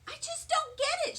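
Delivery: put-on high, grumpy little-girl voice; a high, huffy little girl; grouchy Lucille. A narrator's reading. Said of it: "she grouched".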